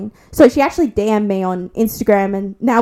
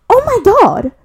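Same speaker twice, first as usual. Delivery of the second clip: shocked disbelief; 8 semitones higher than usual. Retold or imitated. Friend stunned watching her lift the drunk girl, shocked high cry. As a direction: gasp it out like you can't believe your eyes.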